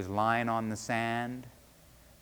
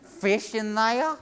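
high, loud, slow disbelieving question; incredulous; 9 semitones higher, 6 dB louder, slower than usual. A narrator's reading. Fisherman questioning the serpent's claim, incredulous. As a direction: draw it out in loud disbelief, pitch climbing.

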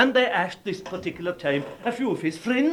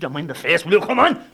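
loud, urging call; loud coaxing; 7 dB louder than usual. Acted. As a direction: rouse him from bed loudly. Friends coaxing loudly.

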